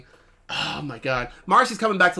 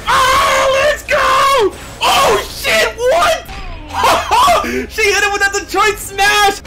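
screaming with hype, much higher and louder; excited shouting; 12 semitones higher, 6 dB louder than usual. Real, not acted. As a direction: cheer her on at the top of your lungs. Hyped reaction to anime fight.

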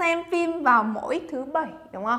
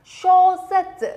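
slow, louder, stretched out; slow model word; 5 semitones higher, 5 dB louder, much slower than usual. Shown as a demonstration. Teacher modeling the word slowly for students. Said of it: "chúng ta đọc là gì đây nhỉ?".

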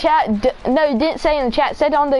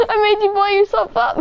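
laughing hard; 9 semitones higher, faster than usual. Real, not acted. Laughing at friend's death.

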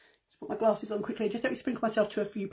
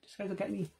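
ordinary talk

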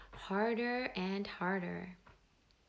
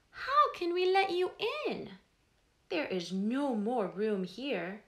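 high, louder squeaky protest; tiny ant protesting; 3 semitones higher, 6 dB louder than usual. Acted. The ant telling the soaked mouse there is no room, squeaky and indignant. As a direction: squeak out the refusal, packed in tight.

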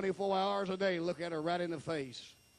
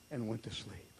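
hushed whisper; 5 semitones lower, 6 dB quieter than usual. A narrator's reading. Wife's death told softly.

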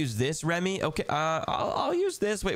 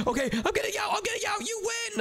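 squeaky high give-up; high panicky surrender. Acted. Forced out, squealing high to brother.

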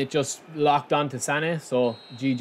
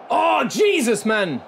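1 relieved exclamation; 8 semitones higher, 4 dB louder, slower than usual. Real, not acted. Final whistle relief.

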